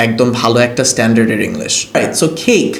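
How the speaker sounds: ordinary talk, nothing marked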